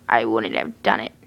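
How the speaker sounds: a hint of the low killer; lightly voiced Jeff. A narrator's reading. Jeff apologizing to the girl, a hint of low voice.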